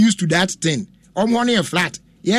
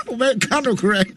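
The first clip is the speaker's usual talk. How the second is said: said through a laugh; 5 semitones higher than usual. Real, not acted. Host laughing at the producer's joke, words breaking into giggles. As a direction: laugh while you say it.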